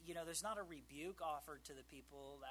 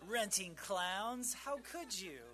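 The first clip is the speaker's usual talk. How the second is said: higher and louder, slow, laughing in fake shock; hammed-up scandalized gasp at the idea; a laughing, pretend-appalled accuser; mock outrage; 6 semitones higher, 9 dB louder, much slower than usual. Mock outrage at renting clowns.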